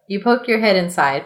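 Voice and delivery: usual speaking voice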